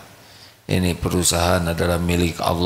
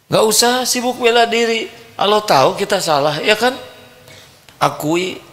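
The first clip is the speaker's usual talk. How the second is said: higher, louder teasing rebuke; mock scolding; 5 dB louder than usual. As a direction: chide them playfully. Preacher scolds defensive listeners. (menegur dengan suara tinggi)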